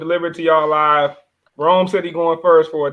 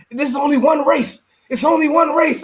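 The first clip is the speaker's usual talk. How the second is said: Sha'Carri quote; 8 semitones higher than usual. Retold or imitated.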